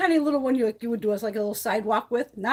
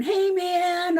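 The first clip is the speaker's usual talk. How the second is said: a raised, cocky "Hey, man"; mock yell; 8 semitones higher than usual. Mimicking the defendant yelling at the judge, mock yell. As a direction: throw out a rude, casual greeting, pitched higher. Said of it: "he just starts screaming and going".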